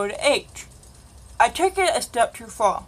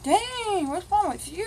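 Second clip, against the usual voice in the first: mock concerned classmate; 8 semitones higher, 4 dB quieter than usual. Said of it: "They're like".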